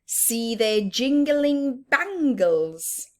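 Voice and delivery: slow, sing-song storybook reading; rhythmic picture book recital; reading a rhyme aloud. Acted. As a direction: read the verse slowly for little ones. Reading rhyming verse to children.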